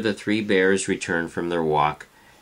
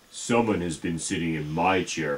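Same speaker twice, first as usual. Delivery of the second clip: big Papa Bear voice; 2 semitones lower than usual. Acted. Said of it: "in his great big voice".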